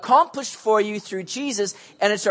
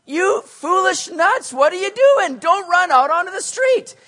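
scolding shout; 10 semitones higher, 4 dB louder than usual. Retold or imitated. Parent scolding kids near street.

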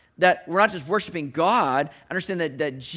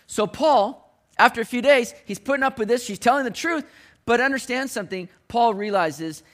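fiery preaching; 4 semitones higher than usual. Acted. Preaching at full force.

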